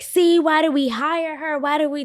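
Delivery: slow, high, sneering rant; lightly hammed-up nasty coworker; mock ranting coworker. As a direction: drag it out like a snide coworker. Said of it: "starts going crazy and starts being like".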